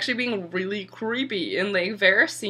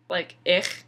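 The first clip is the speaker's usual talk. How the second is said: disgusted ich. Real, not acted. Disgust at creepy guy.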